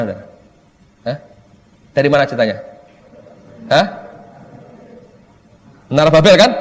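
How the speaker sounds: normal talking voice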